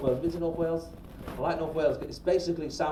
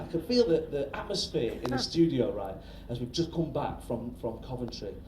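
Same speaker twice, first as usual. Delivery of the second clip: normal talking voice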